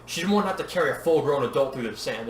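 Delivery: ordinary delivery, nothing marked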